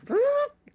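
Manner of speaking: record-scratch noise done by mouth; short, high, loud mock needle scrape; imitated vinyl screech. Joker imitates a radio cutting out, vinyl screech. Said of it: "like the, the vinyl screeching".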